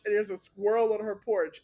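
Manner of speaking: ordinary talk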